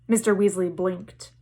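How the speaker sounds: ordinary delivery, nothing marked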